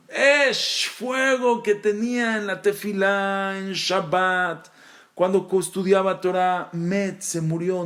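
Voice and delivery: high, impassioned preaching; fervent preaching. Acted. Rabbi stirring his class, impassioned preaching. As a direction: lift the voice with fire.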